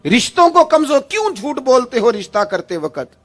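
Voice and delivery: fiery preaching. Acted. Preacher scolding families who lie at weddings, fiery. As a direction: thunder it at the congregation. Preaching against lies at full force.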